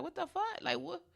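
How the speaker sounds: high, rising disbelief at finding empty shelves; retold shock. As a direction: replay the moment of panic, half-mock. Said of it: "And I was like".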